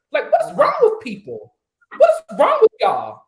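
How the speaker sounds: exasperated outburst at the panel; outraged. Real, not acted. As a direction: let the outrage burst out high. Heated rant at panel.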